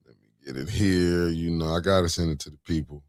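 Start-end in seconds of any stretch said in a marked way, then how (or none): none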